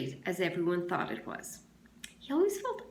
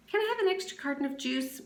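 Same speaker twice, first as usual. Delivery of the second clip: higher, quick pleading ask; a quick, higher boy's plea; eager kid asking; 8 semitones higher, faster than usual. Acted. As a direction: rush the request hopefully. Zigzag asking for extra juice.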